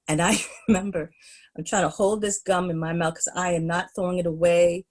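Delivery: ordinary talk, nothing marked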